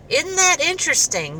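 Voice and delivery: high, excited exclamation; amazed. Retold or imitated. Telling her son about the coincidence, excited high exclamation. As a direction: share the wonder with your son.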